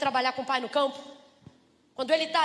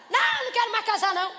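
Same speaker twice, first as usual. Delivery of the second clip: mock denial; 7 semitones higher, faster than usual. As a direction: play the single woman swearing off marriage. Mulher solteira nega querer casar. (negação fingida, aguda e alta)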